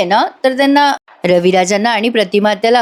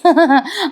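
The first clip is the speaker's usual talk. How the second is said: brief, higher-pitched laugh; amused chuckle; 6 semitones higher than usual. Real, not acted. Amused at caught-out character.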